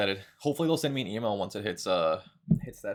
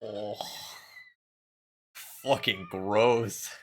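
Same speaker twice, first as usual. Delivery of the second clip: slow, louder, dragged-out disgust; disgusted groan; 4 dB louder, much slower than usual. Real, not acted. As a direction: drag each word out in real revulsion. Reacting to something disgusting.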